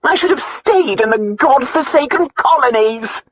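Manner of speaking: high, loud, aggrieved complaint; self-pity. A narrator's reading. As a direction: lament it, feeling sorry for yourself. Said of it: "the self-pity she heard in her aunt's voice".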